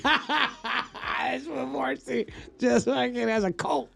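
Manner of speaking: high-pitched, through bursts of laughter; laughing hard. Real, not acted. Cracking up at [name] fans' lost refunds, laughing. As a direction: crack up while getting the words out.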